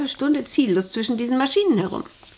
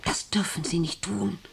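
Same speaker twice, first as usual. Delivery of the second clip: whispered, much softer; hushed warning; 7 dB quieter than usual. Acted. Boss warns the wife in a whisper not to go there. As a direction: breathe the warning under your breath.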